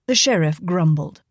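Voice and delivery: ordinary delivery, nothing marked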